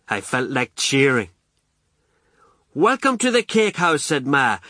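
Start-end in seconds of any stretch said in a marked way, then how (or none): 2.7-4.0 high, hostess's greeting; proud welcome. A narrator's reading. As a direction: welcome the guests grandly, pitched up. Ma welcomes guests to party.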